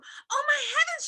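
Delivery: high, loud shocked mother; a mother shrieking in alarm; cried out. Acted. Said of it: "she cried".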